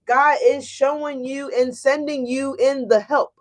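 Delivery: ordinary delivery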